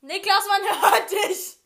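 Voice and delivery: loud, slow, laughing; laughing call. Real, not acted. Calling out, laughing.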